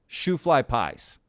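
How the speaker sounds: ordinary talk, nothing marked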